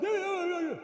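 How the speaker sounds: very high, loud cartoon moose babble; Bullwinkle. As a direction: chatter like a goofy moose at the top of the voice. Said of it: "That's how he talks".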